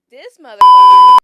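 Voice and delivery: sudden furious yell; angry outburst. Real, not acted. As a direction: blurt it out at full force. Angry retelling of being locked out.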